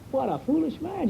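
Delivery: mock scornful neighbour. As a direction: scoff at the man, pitch up on "foolish". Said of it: "you'd say, see?".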